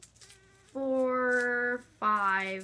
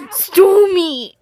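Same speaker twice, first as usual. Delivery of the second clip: Miranda impression; 2 semitones higher, 12 dB louder, slower than usual. YouTuber playing Miranda, sobbing loudly at Joey. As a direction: wail at Joey like a jilted girlfriend, half laughing.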